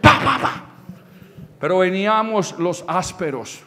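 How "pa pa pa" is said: loud, high "pa" bursts; imitated firework bangs. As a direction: fire off explosive pops.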